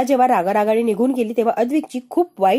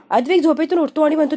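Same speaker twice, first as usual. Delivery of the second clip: ordinary delivery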